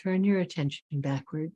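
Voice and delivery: slow meditation voice with long pauses; guided meditation. Real, not acted. Teacher bringing students back to the breath, guided meditation. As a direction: guide them gently inward, leaving long silences.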